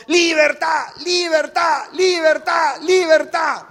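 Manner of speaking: high, loud parrot cry; screaming parrot. Acted. Caged parrot starts shouting at the neighbour, imitated high shout. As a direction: squawk it out as a repeated chant. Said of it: "comienza a gritar el loro".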